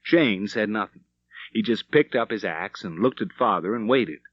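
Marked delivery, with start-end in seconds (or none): none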